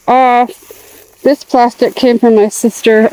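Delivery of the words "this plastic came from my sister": ordinary talk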